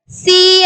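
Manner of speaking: "சி" held long with an s sound; demonstrated CI sound. Shown as a demonstration. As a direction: stretch "சி" as an isolated teaching sound. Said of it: "அப்படின்ற sound". ("சி" என்று இழுத்து உச்சரித்து)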